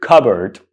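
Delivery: cupboard as "KUB-erd", silent P; correct pronunciation. Shown as a demonstration. Teacher telling learners what to say, cupboard as "KUB-erd". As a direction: reduce the end of "cupboard" to a short "-erd". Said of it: "That's the correct pronunciation".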